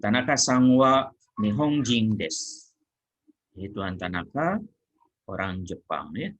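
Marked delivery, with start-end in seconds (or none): 0.0-2.7 slow, loud textbook model answer; model answer read out. Shown as a demonstration. Teacher giving students the model answer, slow and loud.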